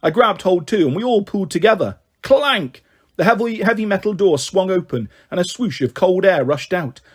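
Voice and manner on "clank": high, ringing exclamation; clanging sound word. A narrator's reading. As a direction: hit it like metal clanging. Narrator voices metal clang.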